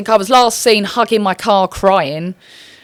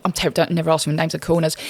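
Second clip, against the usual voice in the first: ordinary delivery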